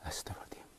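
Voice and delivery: hushed frightened whisper; whispered prayer against a ghost; scared whisper. Real, not acted. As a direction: breathe the prayer out in fear. Praying in fear.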